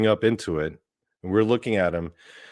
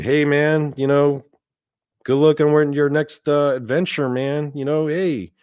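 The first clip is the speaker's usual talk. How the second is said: raised, higher send-off; calling out farewell; 6 semitones higher than usual. Retold or imitated. Team wishing him luck as he rises into the light, calling out. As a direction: call it up to someone drifting away.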